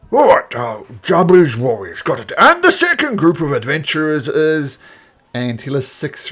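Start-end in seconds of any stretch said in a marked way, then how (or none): 0.1-4.8 put-on elderly orc announcer.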